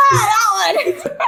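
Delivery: high laughing shout; excited shout. Real, not acted. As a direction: yell it out, laughing. Recognizing the Scratchbox voice.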